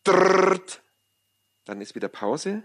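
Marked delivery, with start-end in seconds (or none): 0.0-0.8 rolled machine rattle; buzzing mechanical noise; imitated rattle. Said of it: "es geht so".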